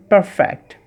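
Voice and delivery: perfect slow and clear, p with both lips, f with lip friction; correct pronunciation. Shown as a demonstration. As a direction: say perfect slowly with a true f. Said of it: "আবার শুনুন". ("perfect" ধীরে, পরিষ্কার ফ উচ্চারণে)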